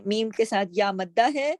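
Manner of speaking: usual speaking voice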